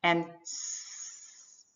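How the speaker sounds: "s" stretched into a long quiet hiss; held s sound. Shown as a demonstration. Teacher showing a continuous sound, long steady hiss. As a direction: sustain the "s" as long as breath lasts. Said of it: "continuous sounds".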